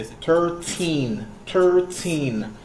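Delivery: put-on thirteen with the last syllable TEEN stressed and drawn out. Shown as a demonstration. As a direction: lean on the second syllable of "thirteen". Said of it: "the teen sound is, like, stressed out".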